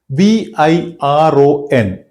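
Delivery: slow letter-by-letter chunk; spelled chunk slowly. Shown as a demonstration. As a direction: dictate "ई एन" as one slow chunk. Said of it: "ये हो गया विरोन". (धीरे-धीरे टुकड़ा बोलें)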